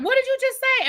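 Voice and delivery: shocked mother questioning her daughter; stunned. Retold or imitated. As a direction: sound floored by the child.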